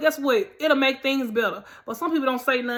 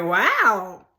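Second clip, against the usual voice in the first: surprised okay; 6 semitones higher, 4 dB louder, much slower than usual. Retold or imitated. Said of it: "I was like".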